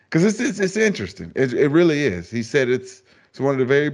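excited. Real, not acted. Host excited about the quote.

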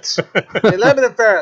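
Irish gran repeating herself, a bit slow; Irish grandmother. Retold or imitated. Grandmother repeating the request, mock Irish accent. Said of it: "That's how my grandmother sounds".

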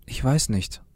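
plain reading voice

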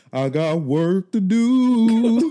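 slow, high, loud diva drawl; [name]. Retold or imitated. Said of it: "What did [name] say".

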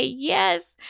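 high, loud praise; excited praise. Shown as a demonstration. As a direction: cheer it out for the child. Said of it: "praising the child".

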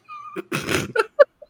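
helpless high-pitched laughter; high squealing laugh. Real, not acted. Cracks up at own story.

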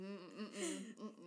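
slow, soft strained hums, half laugh half groan; pained hums. Real, not acted. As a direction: hum in exasperated disgust. Reacting to KKK killer.

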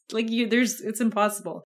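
usual speaking voice

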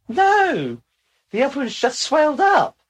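loud, high-pitched comic retort; mock exclamation. Punchline of a retold joke exchange.

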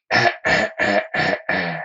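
whispered mock laugh. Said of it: "Pearl's like".

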